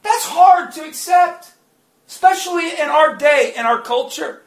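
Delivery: loud, rising preacher's climax, often shouted; preaching at full force. Acted. Preacher testifying to congregation about deliverance, loud and fervent. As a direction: drive the testimony home at full volume.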